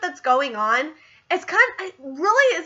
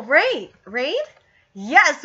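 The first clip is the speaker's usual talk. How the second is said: normal talking voice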